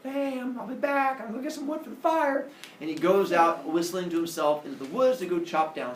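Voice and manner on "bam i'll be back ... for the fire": lightly acted, high-pitched, quick goodbye; mock breezy farewell. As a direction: toss off a high, hurried farewell. Cheerful father leaving to chop wood.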